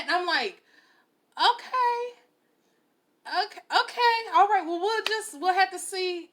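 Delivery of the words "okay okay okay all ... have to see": high-pitched dubious okay; retold doubtful singsong, pitched way up; skeptical. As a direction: humour a boast you don't believe. Doubting his boast.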